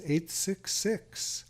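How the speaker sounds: reads the number out slowly, digit by digit; slow, clear phone-number read; slow dictation. Shown as a demonstration. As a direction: spell out the number slowly for callers to write down. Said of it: "if you wanna jot it down".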